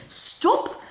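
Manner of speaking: startled yelp; sudden yelp. Real, not acted. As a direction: yelp as the camera slips. Reacting to the camera tipping.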